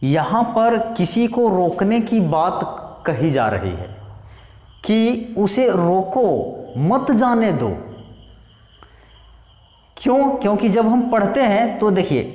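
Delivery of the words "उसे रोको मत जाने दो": उसे रोको held up, a break, then a firm मत जाने दो; pause after रोको. Shown as a demonstration. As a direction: stop briefly after रोको, keep मत with जाने दो. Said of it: "रुक गए, का-, कितना रुकें?". (रोको के बाद रुककर "मत जाने दो")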